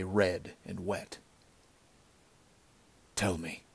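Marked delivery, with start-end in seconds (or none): 3.1-3.6 soft and soothing, as to a babe. A narrator's reading. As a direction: coax her quietly and tenderly. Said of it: "His voice was soft, soothing".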